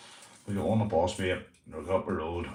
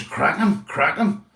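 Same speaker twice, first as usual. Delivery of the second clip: raised, higher urging; imitated egging-on; 6 semitones higher, 5 dB louder, slower than usual. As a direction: mimic a lad goading someone to punch. Boy urging on a fight.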